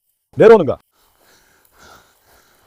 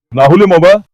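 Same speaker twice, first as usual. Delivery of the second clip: loud; 10 dB louder than usual. Real, not acted. Excited at the dog's catch.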